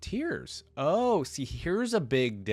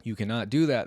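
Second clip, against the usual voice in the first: louder, forceful objection; yelling; 6 dB louder than usual. A narrator's reading. The consul forbids Cole's plan, yelling. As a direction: raise the voice in protest. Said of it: "the consul yells".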